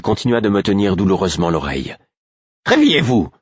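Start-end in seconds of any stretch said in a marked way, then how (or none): 2.7-3.3 high, loud stern command; stern order. Acted. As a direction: bark the order at him. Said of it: "ordonna-t-elle d'un ton sévère". (ordre sévère et fort)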